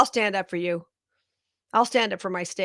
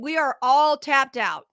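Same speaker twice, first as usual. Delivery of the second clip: louder and higher, slowed right down, every word hammered; fed-up shout that the public has nothing left; loud exasperated declaration; 5 semitones higher, 8 dB louder, slower than usual. Real, not acted. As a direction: shout it like you're fed up with paying. Angry rant about state handouts.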